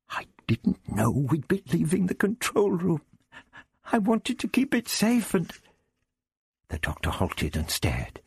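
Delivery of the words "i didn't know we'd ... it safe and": high-pitched, anxious excuse; anxious confession. A narrator's reading. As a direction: falter upward as you own up. Vicky confesses, distressed, to the Doctor.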